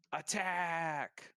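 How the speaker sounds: a battle cry as heard a block away; distant shout. Acted. Game master voicing a shout heard from afar, restrained shout. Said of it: "just shout".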